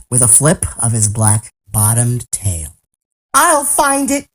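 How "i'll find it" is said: high, determined elephant; crying out. A narrator's reading. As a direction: cry it out as a vow. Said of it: "cried Horton".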